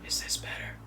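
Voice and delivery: whispered, barely there; girl whispering into the giant's ear; under her breath. A narrator's reading. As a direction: breathe it without voice. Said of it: "speaking right under her breath".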